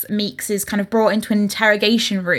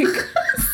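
unvoiced giggle; real delighted laugh; airy high giggle; high breathy laugh; 4 dB quieter than usual. Real, not acted. Laughing at the quote.

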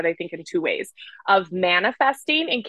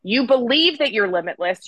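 ordinary talk

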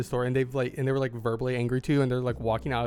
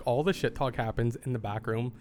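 ordinary talk, nothing marked

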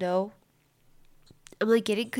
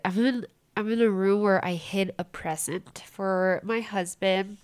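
ordinary talk, nothing marked

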